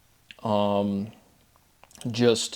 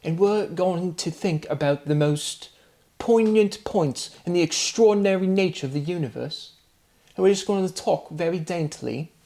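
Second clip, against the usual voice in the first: higher mock English accent, dainty and musing; higher, dainty English-accent parody of a philosophy talker; Alex O'Connor English accent; 6 semitones higher than usual. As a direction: lampoon a posh English accent, pondering grandly. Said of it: "with Alex O'Connor in his English accent".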